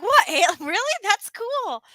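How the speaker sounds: surprised. Retold or imitated. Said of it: "we were like".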